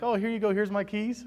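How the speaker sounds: imagined car owner cheerfully giving in; mock compliant. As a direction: play the pushover who hands over keys. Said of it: "Would you be like".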